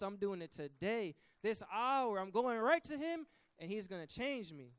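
resolute David. Acted. David vowing to God to repent today, determined. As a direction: declare it now, no delay. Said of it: "But David said".

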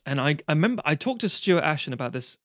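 usual speaking voice

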